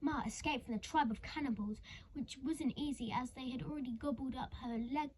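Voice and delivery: ordinary delivery, nothing marked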